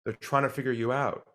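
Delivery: ordinary talk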